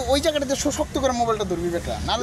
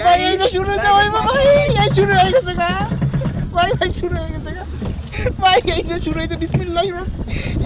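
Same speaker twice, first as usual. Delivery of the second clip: high, excited yelps as the ride starts; excited on a roller coaster; 7 semitones higher than usual. Real, not acted. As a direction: call out to your friend as it starts.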